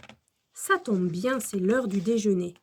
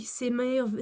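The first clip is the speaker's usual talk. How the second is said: ordinary delivery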